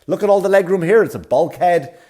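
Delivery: hammed-up delight at the bulkhead seat; mock excited boast. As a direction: gush about the extra legroom in a high mocking voice. Mocking wheelchair woman's boast.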